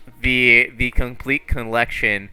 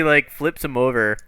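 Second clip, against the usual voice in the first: usual speaking voice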